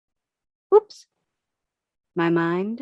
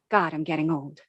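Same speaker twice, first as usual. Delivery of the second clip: ordinary delivery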